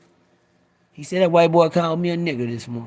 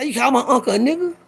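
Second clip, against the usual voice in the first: confrontation; 8 semitones higher than usual. Retold or imitated.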